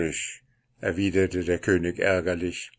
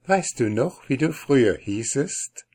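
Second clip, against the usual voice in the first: playful, higher teasing; 5 semitones higher than usual. Acted. The princess teases her husband about his old name, playful. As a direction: tease him mischievously. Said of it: "wenn sie recht übermütig lustig war".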